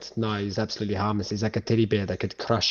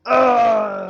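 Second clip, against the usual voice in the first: retold giant cry; 13 semitones higher, 8 dB louder than usual. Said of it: "he's like".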